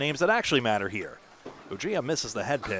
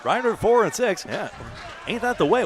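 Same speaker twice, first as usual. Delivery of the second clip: lively call; 8 semitones higher, 3 dB louder than usual. Real, not acted.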